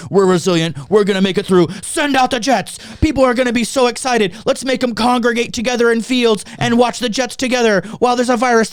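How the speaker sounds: cocky, rising into high mock cheer; mocking government voice. Mocking officials rallying the boys, high and cocky. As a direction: sneer like a smug official pep talk. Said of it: "They're like".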